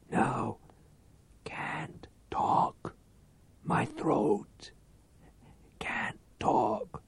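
put-on slow, breathless whisper of a dying old man; faint whisper. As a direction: barely get the words out. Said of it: "words as faint as dust".

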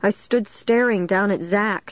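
ordinary delivery

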